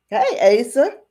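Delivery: high, loud friendly hail; calling out. Retold or imitated. Ranch hand hailing Asa in the street, calling out.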